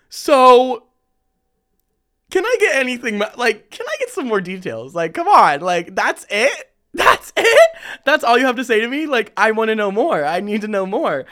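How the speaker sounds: high, loud and excited; playful high-pitched demand; eager pestering. Retold or imitated. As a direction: beg for the juicy details.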